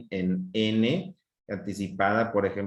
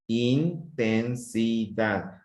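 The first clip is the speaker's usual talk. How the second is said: "Intensidad" slowly split as in, ten, si, dad; syllable by syllable; much slower than usual. Shown as a demonstration. Teacher showing correct short splits, slow four-beat syllables. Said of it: "la separación de las letras".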